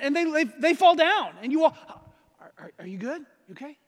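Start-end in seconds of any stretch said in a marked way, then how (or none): 2.4-3.8 softer, worried check-in; concerned. Shown as a demonstration. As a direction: ask gently after someone falls. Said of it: "if you're concerned about someone".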